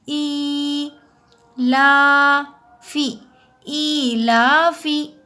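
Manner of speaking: ई ला फी said slowly, each syllable stretched; slow, stretched reading of ई ला फी; drawn-out syllables. Shown as a demonstration. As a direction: stretch ई and फी long. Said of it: "ऐसे पढ़ेंगे".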